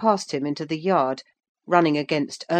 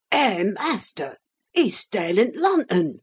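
put-on old man's shout; 5 semitones higher than usual. A narrator's reading. Old servant reporting the theft to his master, shouting. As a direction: call out as an old servant, restrained volume. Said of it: "shouted the ancient".

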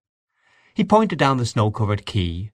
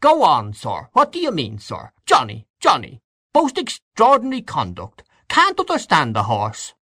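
pompous old man's outraged imitation, pitch leaping high; indignant old gentleman; 6 semitones higher than usual. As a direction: scold the horse with mock indignation. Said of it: "was highly indignant".

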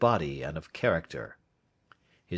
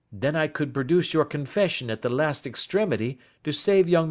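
higher-pitched, businesslike detective voice; composed Holmes; 8 semitones higher, 5 dB louder than usual. Acted. Detective offering terms for a confession, composed. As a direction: lay out the terms and promise discretion.

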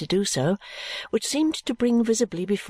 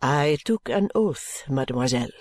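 low, measured French servant; mild French maid; 6 semitones lower than usual. Acted. French maid parts from mademoiselle with a curtsy, low and measured. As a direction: speak low and accented, politely taking leave. Narrator voices maid taking leave.

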